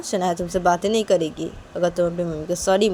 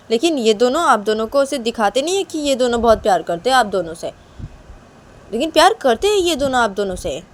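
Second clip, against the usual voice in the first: a little higher, mildly insistent; lightly voiced earnest daughter; 5 semitones higher, 8 dB louder than usual. Shanaya tells parents they are loved, mildly insistent. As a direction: gently play a girl convincing her parents.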